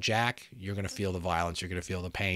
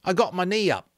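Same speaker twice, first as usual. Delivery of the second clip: put-on higher, louder boy, plainly proud; proud of his move, no fuss; simple pride; 11 semitones higher, 6 dB louder than usual. Eric telling Ralph about the fight, simple pride. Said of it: "with simple pride".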